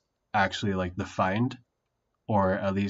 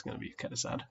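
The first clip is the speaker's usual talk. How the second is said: whispered aside; 12 dB quieter, much faster than usual. Real, not acted. Worried about getting words wrong.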